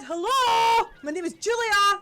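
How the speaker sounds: put-on young woman's voice; high mermaid voice. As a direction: pitch way up as the sweet mermaid. Player voices mermaid Julia.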